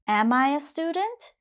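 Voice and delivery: "Am I a student" gliding up to a high finish; rising question intonation. Shown as a demonstration. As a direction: ask it, pitch climbing through "student". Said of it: "my intonation goes up for the question".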